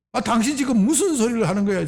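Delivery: sharp, rising protest, hammed up; mock rebuke. Preacher voicing Peter scolding Jesus, mock angry protest. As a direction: scold him like an outraged friend. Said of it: "이런 뜻이에요".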